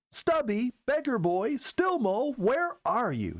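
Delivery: calling out. A narrator's reading. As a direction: call the animals from afar, drawn out. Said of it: "she called".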